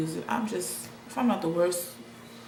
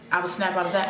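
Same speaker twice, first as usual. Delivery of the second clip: normal talking voice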